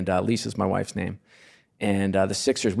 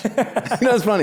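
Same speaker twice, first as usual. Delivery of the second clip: laughing; 11 semitones higher, 5 dB louder, much slower than usual. Real, not acted. Laughing at his own line.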